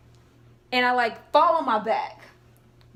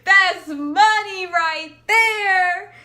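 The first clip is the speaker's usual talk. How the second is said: much higher, louder, slow stretched cheer; hyped drawn-out boast; 7 semitones higher, 10 dB louder, much slower than usual. Retold or imitated. Her boast to herself as the three-pointer flies, loud and drawn out. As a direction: stretch it out, sure it's going in. Watching her shot arc.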